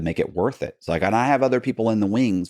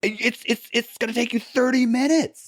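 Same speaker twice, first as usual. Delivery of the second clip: much higher, squeaky disbelief; incredulous squeak. Retold or imitated. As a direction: squeak it out, amazed it's so cheap. Teller explains to Eric, amazed.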